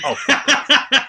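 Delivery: mock exasperation. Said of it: "I was like".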